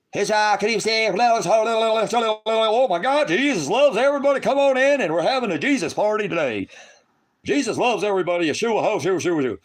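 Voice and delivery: high, loud, gushing parody of a worshipper; mock tongues-speaker. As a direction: ham up an ecstatic believer babbling.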